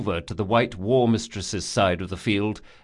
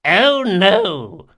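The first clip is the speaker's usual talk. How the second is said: put-on high, loud old man; 9 semitones higher, 8 dB louder than usual. A narrator's reading. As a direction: call back gleefully. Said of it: "said the old man".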